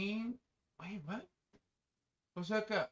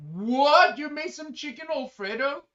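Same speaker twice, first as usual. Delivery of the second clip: surprised; 6 semitones higher, 8 dB louder than usual. Real, not acted. Surprised by the Alfredo claim.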